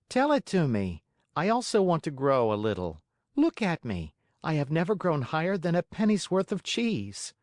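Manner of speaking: put-on high boyish puppet voice; a lightly voiced young puppet; Pinocchio voice. A narrator's reading. Pinocchio pleads.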